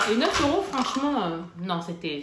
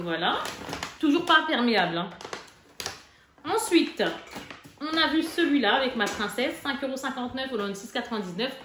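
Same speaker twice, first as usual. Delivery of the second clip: usual speaking voice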